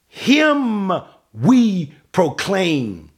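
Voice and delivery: "Him" held high and set apart before "we proclaim"; stress on HIM. Shown as a demonstration. Preacher showing Paul's emphasis, heavy stress on "Him". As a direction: punch "Him", pause, then slow "we proclaim". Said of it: "see the emphasis that Paul is placing".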